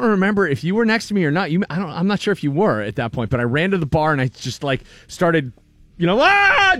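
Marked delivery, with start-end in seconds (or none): 6.2-6.8 high, loud "ah" like an excited fan; imitated fan scream; crazed fan yell. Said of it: "doing the, you know, Steeler fan thing".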